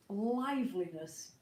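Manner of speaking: ordinary delivery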